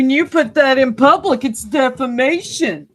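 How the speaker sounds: loud, high mocking echo of the guest; mocking parody. Host mocks the guest's complaint in a loud, high mocking echo.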